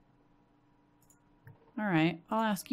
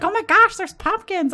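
much higher and louder, thrilled gasp of joy; excited squeal; 12 semitones higher, 10 dB louder than usual. Retold or imitated. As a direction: imitate getting all excited over pumpkins. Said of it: "I was about to be so excited".

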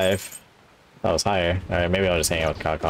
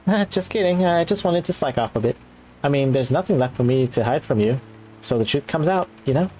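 lightly voiced maid, pitched up at first; casual confession in a slightly raised character voice; candid maid character; 7 semitones higher, 3 dB louder than usual. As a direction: own up playfully as the maid. Voices Kawakami admitting she slacks off.

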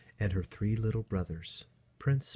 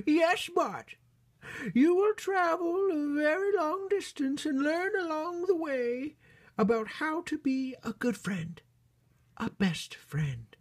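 very high, loud falsetto storybook queen; queen bunny character; 5 dB louder than usual. Acted. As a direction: play a squeaky-high royal mother bunny.

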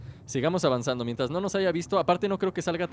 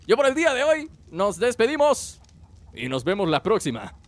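youtuber outro; 3 semitones higher, 3 dB louder than usual. Acted. Channel outro.

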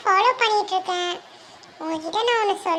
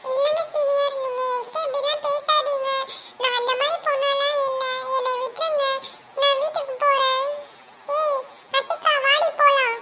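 put-on higher, pleading girl wanting to go home; distressed young woman; 7 semitones higher than usual. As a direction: beg to be left alone, pitched up. Kavi pleads and flees. (உயர்ந்த குரலில் பதறும் இளம்பெண்)

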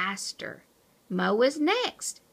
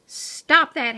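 scolding; 4 semitones higher, 8 dB louder than usual. Acted. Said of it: "said Chuckles".

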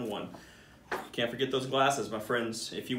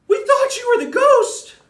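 very high, much louder frightened squeal; scared shriek; 14 dB louder than usual. Acted. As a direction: shriek as if the ghost just walked in. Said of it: "The monkeys all gasp".

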